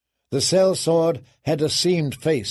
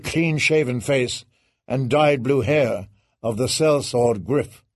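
ordinary delivery

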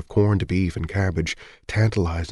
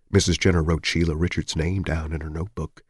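usual speaking voice

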